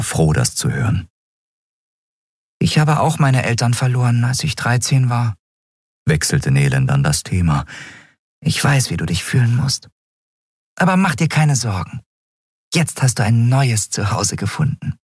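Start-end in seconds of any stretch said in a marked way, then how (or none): 2.6-5.4 higher, light comforting voice; friendly winged sprite, pitched up; reassuring little sprite. A narrator's reading. Winged sprite confides in the boy. 8.4-15.0 higher, light comforting voice; friendly winged sprite, pitched up; reassuring little sprite. A narrator's reading.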